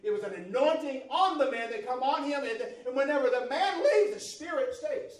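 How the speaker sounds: loud, high, fervent preaching; shouting the sermon up to a climax; full-force preaching. Real, not acted. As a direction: preach it out with rising fire.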